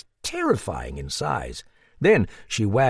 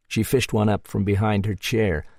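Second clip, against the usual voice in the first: ordinary delivery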